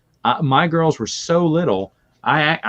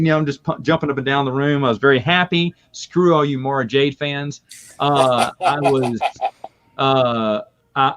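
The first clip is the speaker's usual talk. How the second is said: ordinary talk, nothing marked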